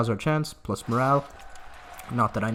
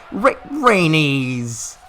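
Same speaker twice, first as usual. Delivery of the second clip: slow louder groaning cry; stretched wail; 4 semitones higher, 5 dB louder, much slower than usual. Real, not acted. Player wailing at the burning, stretched out. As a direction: drag the name out in despair. Dragon ruining everything.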